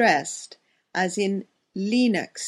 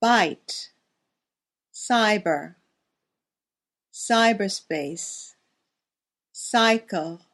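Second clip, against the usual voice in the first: each word said alone and clearly, with long pauses; careful teacher demo of single words; pronunciation drill. Shown as a demonstration. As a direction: model every word for learners to repeat. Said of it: "Listen and repeat".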